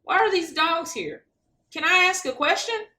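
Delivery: put-on high, eager child asking; excited kid voice. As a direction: pipe up like a curious kid. Kid voice at debate.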